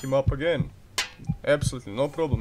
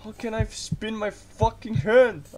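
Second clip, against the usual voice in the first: ordinary talk, nothing marked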